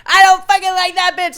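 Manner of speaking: put-on drunk angry yell. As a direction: snarl it out, drunk and furious. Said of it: "I'm just like".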